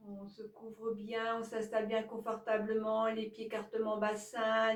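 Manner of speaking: calm, even yoga nidra guidance; guided relaxation. Acted. Yoga teacher guiding listeners to release each body part, calm guided relaxation.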